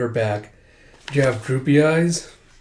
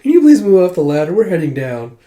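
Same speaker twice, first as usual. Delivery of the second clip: quick, raised acted politeness; polite request; 5 semitones higher, 6 dB louder than usual. Dragons asking soldiers to clear the ladder, politely. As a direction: ask very politely, with mock courtesy. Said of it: "They're being all polite".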